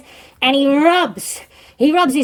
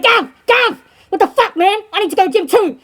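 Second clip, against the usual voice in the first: retold indignant call, higher pitched; 6 semitones higher than usual. Prisoner calls to the guards from his cell, indignant call. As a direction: call out in mock protest, pitch raised.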